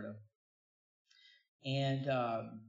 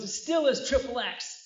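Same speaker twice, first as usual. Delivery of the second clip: preacher quote; 10 semitones higher, 8 dB louder than usual. Retold or imitated. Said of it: "He goes".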